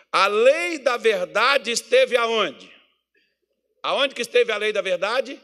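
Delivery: loud, high, full-force preaching; fiery pulpit preaching. Acted. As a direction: drive the point home to the congregation.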